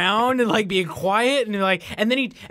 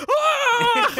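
panicked scream. Retold or imitated. Kukuchiro fleeing the bandit posse, high mock scream. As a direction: yelp like a fool fleeing a posse. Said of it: "Kukuchiro is kinda like".

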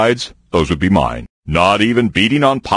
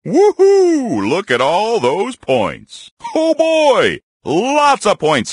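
excited cheer; 12 semitones higher, slower than usual. Acted. Bear cheers at points.

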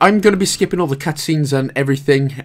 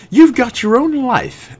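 high silly voice; 10 semitones higher, 3 dB louder, slower than usual. Pretend. Jokey aside to viewer.

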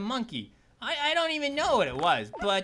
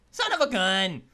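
usual speaking voice

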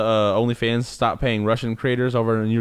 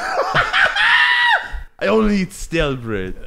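put-on high, slow, hammy Russian accent; mock straining Russian; 7 semitones higher, much slower than usual. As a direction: strain it out slowly as a caricature Russian. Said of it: "tryna squeeze a turd out".

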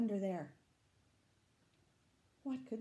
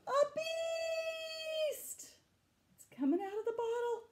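much higher, fast startled cry; reading a scary reveal to children, high and alarmed; alarmed exclaim; 6 dB louder, slower than usual. Acted. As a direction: gasp and cry out the beast is here. Beast appears, reader exclaims.

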